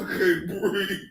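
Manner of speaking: usual speaking voice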